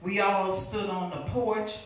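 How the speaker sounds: high, forceful spoken-word delivery; dramatic poetry reading at full intensity; impassioned poem recitation. Acted. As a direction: drive the verse hard, pitch lifted.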